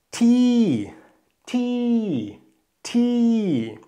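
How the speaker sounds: teacher drills "Ti" with a high falling tone; falling tone Thai. Shown as a demonstration. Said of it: "Tonzeichen für den fallenden Ton".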